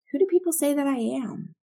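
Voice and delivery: lightly voiced Jesus asking; voicing Jesus. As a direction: ask it as Jesus would. Jesus questioning disciples, voiced.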